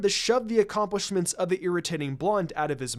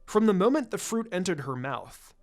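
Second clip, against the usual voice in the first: solemn legend reading; 4 semitones lower than usual. A narrator's reading. The narrator reads Hinata's scroll fable, lower and solemn. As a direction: intone it like an old myth from a scroll.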